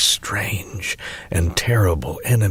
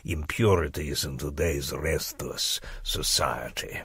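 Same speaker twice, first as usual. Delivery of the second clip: softer, secretive mad-scholar voice reading his letter; eccentric doctor's letter; 9 dB quieter than usual. Acted. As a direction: play a conspiratorial old professor, dropping to whispers. Doctor's eccentric letter read aloud.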